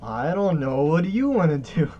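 high loud dragon voice. Pretend. Dragon dialogue, voiced back.

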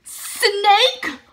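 high loud alarmed yelp of the word; shocked shriek. Real, not acted. Vlogger reading a face mask label, shocked yelp. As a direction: recoil in real shock at the label.

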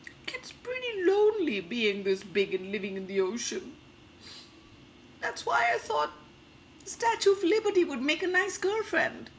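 high-pitched monster making excuses; Godzilla. Acted. Godzilla apologizing for his accidents, high and pleading. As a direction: whine it high, with bursts of loudness and long pauses. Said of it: "I have to become Godzilla".

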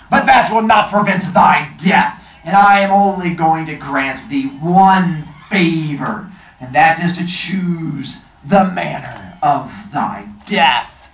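loud, slow grim genie. Acted. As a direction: pronounce a death sentence. Genie pronounces a death sentence.

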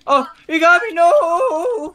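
high loud wail; shouting. Real, not acted. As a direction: shout in dismay. Caught by the enemy.

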